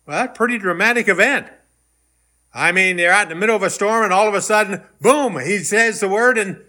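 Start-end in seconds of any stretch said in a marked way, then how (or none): none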